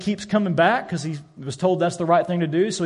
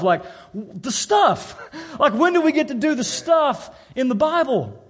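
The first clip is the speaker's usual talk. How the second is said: rising, excited, pleading higher; eager plea; 4 semitones higher than usual. Retold or imitated. New believer excitedly pleads with his pastor, eager and higher. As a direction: pour it out like a thrilled newcomer.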